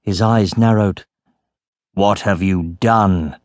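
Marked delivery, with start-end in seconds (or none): 1.9-3.4 slow, low accusing question; grave accusation. A narrator's reading. Captain confronts boy over the opened sack, grave accusation.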